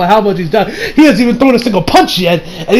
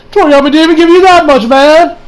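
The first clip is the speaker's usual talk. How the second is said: higher and louder, calling out in disbelief; exasperated retort; 8 semitones higher, 5 dB louder than usual. Retold or imitated. Fan telling Vegeta he got shortchanged, higher and louder. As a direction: protest on Vegeta's behalf, pitched up. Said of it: "And I'm like".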